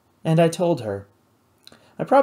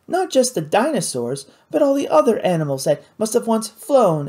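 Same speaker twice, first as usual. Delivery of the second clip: put-on higher, eager young lady explaining; a lively, clever girl sharing her knowledge; Kate's voice; 7 semitones higher than usual. Kate marvels at extinct animals.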